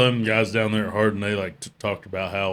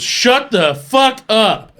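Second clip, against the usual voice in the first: slow, high, loud mock yell; retold breathless outburst; 13 semitones higher, 8 dB louder, much slower than usual. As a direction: force out each word loudly, as if it's all you can get out. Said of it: "that's all I got out was".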